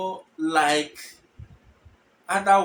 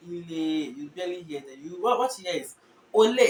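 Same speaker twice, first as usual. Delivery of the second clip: ordinary talk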